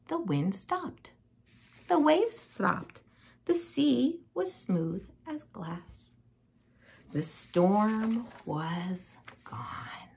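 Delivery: slow, soft, drawn-out narration; slowing down to a near whisper; hushed calm. A narrator's reading. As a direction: let every phrase settle into stillness. Storm falls calm.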